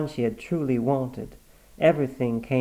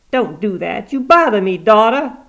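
put-on higher, louder peevish old mother; cranky old woman; 10 semitones higher, 10 dB louder than usual. Granny complaining about her face being washed, peevish. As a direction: grumble like a stubborn invalid.

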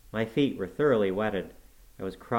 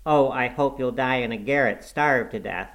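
lightly voiced cross boy, pitched up; a touch of petulant, raised complaint; peevish invalid boy; 4 semitones higher, 5 dB louder than usual. A narrator's reading. Boy cursing his negligent attendant, peevish.

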